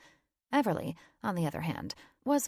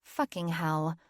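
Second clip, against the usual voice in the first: usual speaking voice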